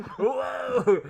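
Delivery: loud, high shout of amazement; excited shout. Real, not acted. Streamer yelling at a price spike, loud and high. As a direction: yell it out at the chart. Reacting to a big market move.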